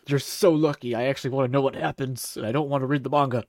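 normal talking voice

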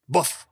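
loud rising boof; dog punctuating its tale with a bark; gruff bark. Acted. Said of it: "strange, gruff voice".

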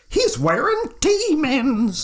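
high, slow horrified gasp of an imitation; mock scandalized onlooker. Imitating people who see the Krampus shirt, mock scandalized. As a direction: draw it out high, hammed-up shock. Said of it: "people that are like".

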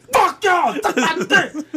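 high, hyped rapper yell, mocking; mock rapper ad-lib. Mocking a rapper's ad-lib to friends, high hype yell. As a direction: shout it like a hype man on a track.

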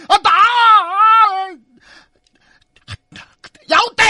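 long, high full-out yell; big ghost's battle cry. A narrator's reading. Big ghost reveals himself to attack, shouting. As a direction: bellow a drawn-out attack cry. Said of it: "大鬼喊道".

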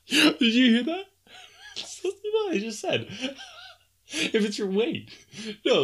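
high, giggling words squeezed out between laughs; can't stop laughing at his own joke; laughing fit. Real, not acted. Host cracking up at his own weight joke, laughing through the words.

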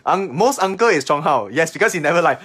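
normal talking voice

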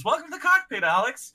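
high-pitched chirpy ship announcer; talking ship. Retold or imitated. Ship greeting Alex as he enters, high chiming voice. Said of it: "the ship, like, notices. It says".

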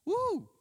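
high excited whoop; a sudden high woo of hype; mock woo. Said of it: "Somebody who's just like".

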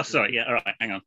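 normal talking voice

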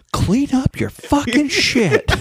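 put-on high, slow, laughing little-girl voice; imitated little girl. Dad mimics his toddler repeating a swear, high and slow.

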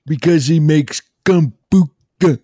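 high, slow goofy brother voice; Ben and Jerry character. Acted. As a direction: draw it out slowly, high and in character. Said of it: "It's Ben and Jerry".

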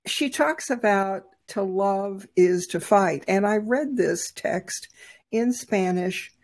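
normal talking voice

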